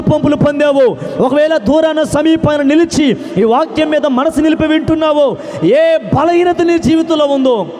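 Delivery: high, loud, slow fervent preaching; preaching at full force. Acted. Pastor blessing the listeners at night, fervent high loud preaching. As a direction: pour out the blessing over the crowd.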